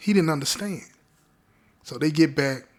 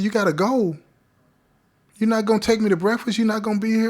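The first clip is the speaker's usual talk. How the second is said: higher-pitched mock protest, questions pushed out; imitated disbelief; 6 semitones higher, faster than usual. Girlfriend to man leaving before breakfast, imitated disbelief. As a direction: retell her incredulous questions in a raised pitch.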